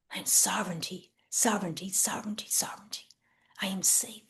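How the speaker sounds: soft whispered chant of sovereignty; whispered affirmation. Shown as a demonstration. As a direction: breathe the words like a mantra.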